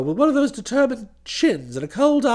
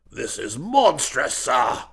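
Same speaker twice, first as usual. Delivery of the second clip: low, slow indignation; affronted old duke; 11 semitones lower, slower than usual. A narrator's reading. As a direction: protest slowly in a low, restrained voice.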